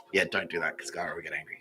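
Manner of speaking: usual speaking voice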